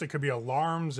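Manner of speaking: normal talking voice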